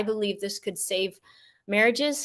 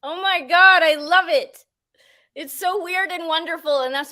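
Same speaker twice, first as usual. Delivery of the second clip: high, louder delighted gush; 8 semitones higher, 5 dB louder than usual. Real, not acted. Host delighted after the exercise.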